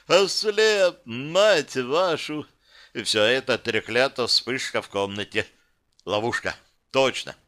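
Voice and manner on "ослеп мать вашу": high outburst, dragged-out curse; angry dismay. Acted. Blinded captive curses his kidnappers, angry dismay. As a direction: burst out higher, then stretch the curse.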